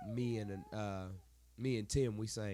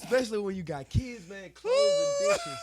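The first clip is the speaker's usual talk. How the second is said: exasperated wail; 12 semitones higher, 10 dB louder than usual. Real, not acted. Exasperated about endless chores.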